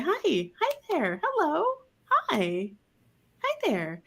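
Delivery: gentle high hello to a shy dog; greeting a dog, soft and high; soft inviting greeting. Shown as a demonstration. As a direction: coax softly, rising then settling. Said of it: "open your hands and say".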